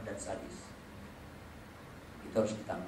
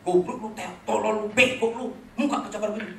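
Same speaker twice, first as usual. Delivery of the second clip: father's insults; 7 semitones higher, 7 dB louder than usual. Retold or imitated.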